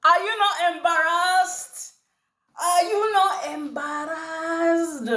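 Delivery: put-on Nigerian accent, high and slow. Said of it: "In my Nigerian accent".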